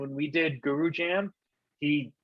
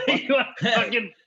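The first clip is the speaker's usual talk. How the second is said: laughing mock echo; 8 semitones higher, 5 dB louder than usual. Mocks friend's challenge while laughing.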